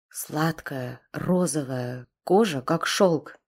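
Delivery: тихо и медленно, с хрипотцой; смакуя каждое слово вполголоса; хрипло. A narrator's reading. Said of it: "хрипло проговорил". (хриплый шёпот насильника, смакующего слова)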